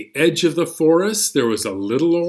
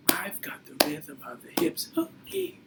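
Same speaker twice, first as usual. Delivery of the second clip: whispered echo; 10 dB quieter than usual. Acted. Teacher repeating the rhyme for kids, whispering.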